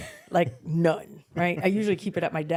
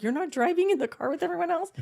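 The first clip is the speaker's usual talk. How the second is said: surprised; 11 semitones higher than usual. Retold or imitated. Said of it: "She's like".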